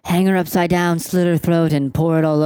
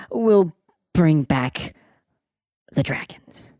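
hushed prophecy. Acted. GM whispers the prophecy ending.

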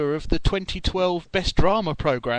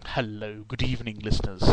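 lower, softer, unhurried plummy-announcer greeting; a light Stephen Fry take on a grand welcome; lower, softer, mock-grand Stephen Fry hello; 7 semitones lower, 7 dB quieter than usual. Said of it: "as if I'm Stephen Fry".

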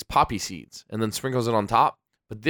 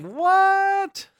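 a high, squeaking "What?" in mock disbelief; reliving the shock, pitch shooting way up; stunned high yelp of disbelief. Shocked that the ad was Brady.

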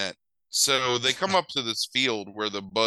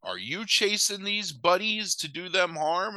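higher, slow, put-on interrogating voice; suspicious biker; 8 semitones higher than usual. Bikers questioning the two idiots, in a slow higher put-on voice. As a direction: lean in slowly, a biker sizing them up. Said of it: "the bikers are like".

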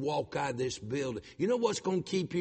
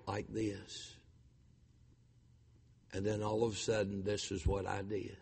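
low, soft, solemn telling with long pauses; quiet, reverent recounting of a death; hushed testimony; 5 semitones lower, 6 dB quieter than usual. Real, not acted. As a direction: let the voice drop away into stillness. Retelling wife's death in a hush.

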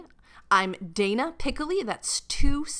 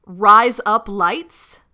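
put-on "Rise Up Lights" said slowly in an Australian accent, sounding like "razor blades"; 8 dB louder, much slower than usual. Saying it for the guest in an Australian accent. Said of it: "say it again".

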